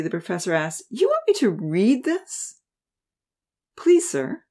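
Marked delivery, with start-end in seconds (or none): 0.9-2.6 slow, scornful, higher question; disdainful. Acted. Professor scoffing at student's stapled article, disdainful and slow. 3.8-4.4 high, pleading please; begging. Acted. Student begging his professor to read, pleading high. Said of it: "Seth begged".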